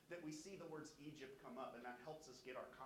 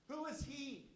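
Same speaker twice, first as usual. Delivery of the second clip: proud scoff; 11 semitones higher, 7 dB louder than usual. Acted. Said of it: "Do you hear their pride?".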